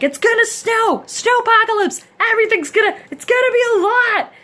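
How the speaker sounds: high, loud hammed-up alarm; doom-laden mock forecast, pitched high and loud; mock forecast panic. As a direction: shriek it like a frantic weatherman. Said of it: "the forecast was like".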